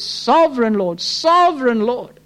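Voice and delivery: desperate prayer. Acted. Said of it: "the pungency of his, of his prayers".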